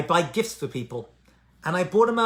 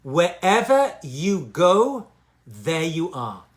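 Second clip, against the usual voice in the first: "Wherever you go" drawn out very slowly; slow it right down to let it sink in; slow repeat; much slower than usual. Shown as a demonstration. Rabbi repeats the slogan for his listeners, slowly. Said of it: "I'll say that again".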